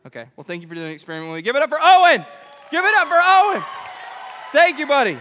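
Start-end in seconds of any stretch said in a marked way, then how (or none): 1.4-5.2 loud, high cheering shout; shouted applause call. Real, not acted. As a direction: whip the crowd up to applaud. Calling for applause for the volunteer.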